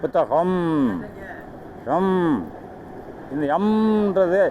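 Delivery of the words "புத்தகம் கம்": புத்தகம், then கம், each ending on a long hummed "m"; final consonant shown. Shown as a demonstration. Teacher isolating the final consonant for students, held final sound. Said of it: "இம்முன்றது யாரோடது".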